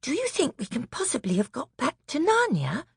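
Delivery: high hopeful question; little girl full of wonder. Acted. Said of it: "exclaimed Lucy".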